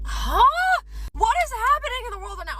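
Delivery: high-pitched, fast disbelief; bewildered outburst. Retold or imitated. Reacting to a hateful text, high bewildered outburst. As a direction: blurt it high and fast, utterly baffled.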